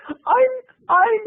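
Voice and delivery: high, stammering, scared reply; flustered high-pitched stammer; panicked squeak. Retold or imitated. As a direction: squeak it out like a caught kid. Flustered answer to the woman.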